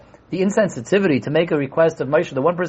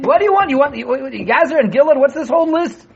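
impatient; 8 semitones higher than usual. Acted. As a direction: push back at the long list. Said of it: "be impatient with someone".

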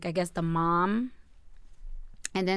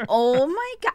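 shocked; 5 semitones higher than usual. Retold or imitated. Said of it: "and I'm like".

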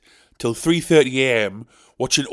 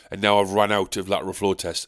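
ordinary talk, nothing marked

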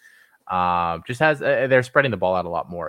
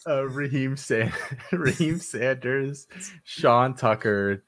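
slow reading through a laugh; 7 semitones higher, slower than usual. Real, not acted. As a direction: drag each name out slowly. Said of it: "I'll read real slow".

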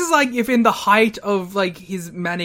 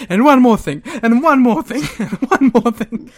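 mock ranting promo; 6 semitones higher, 7 dB louder than usual. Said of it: "he's just going".

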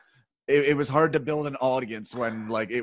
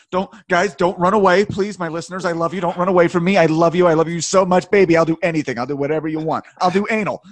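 loud, higher-pitched begging, played for laughs; mock desperate pleading; 5 semitones higher, 10 dB louder than usual. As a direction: beg like a clingy lover, hammed-up. Mock begging listeners not to leave.

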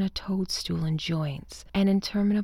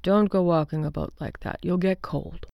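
a lightly voiced husband, gently chiding; husband John. A narrator's reading. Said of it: "he said".

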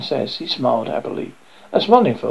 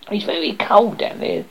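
remarking frog; 8 semitones higher than usual. A narrator's reading. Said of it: "the frog remarked".

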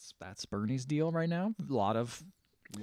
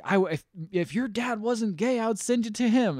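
high, acted-out scolding threat; retold exasperation; 9 semitones higher than usual. Mother threatening to send son away, retold exasperation. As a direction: play the fed-up parent.

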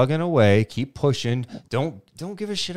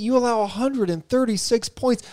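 much higher, pointed reporter question; press conference reporter; 11 semitones higher than usual. Pretend. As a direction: grill the coach at the podium. Host plays reporter grilling coach.

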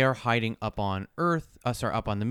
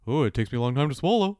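lightly voiced giraffe; shy airy giraffe; 4 semitones higher, 4 dB louder than usual. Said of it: "I love my giraffe voice".

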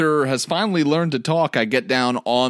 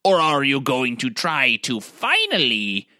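put-on eccentric old wizard; 4 semitones higher than usual. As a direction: call out like a half-mad hermit wizard. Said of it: "I say out loud".

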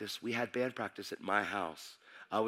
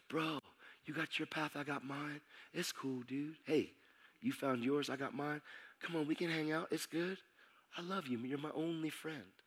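soft, slightly raised voice of a laid-back friend; gentle reassurance; 3 semitones higher, 8 dB quieter than usual. Retold or imitated. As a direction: imitate a buddy smoothing things over quietly. Best friend gently reassures him, retold.

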